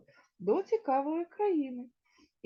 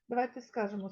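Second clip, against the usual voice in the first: ordinary talk, nothing marked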